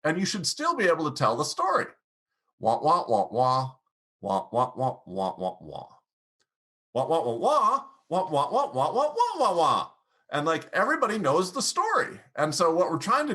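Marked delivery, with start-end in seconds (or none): 2.6-3.7 muted wah-wah nonsense syllables; cartoon teacher's wordless drone; Charlie Brown's teacher. Shown as a demonstration. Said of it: "like Charlie Brown's teacher". 4.2-5.9 falling, fading nonsense syllables; story going down. Shown as a demonstration. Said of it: "like Charlie Brown's teacher". 6.9-9.9 high, laughing, rising wah syllables; excited upward nonsense syllables; story going up. Shown as a demonstration. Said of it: "like Charlie Brown's teacher".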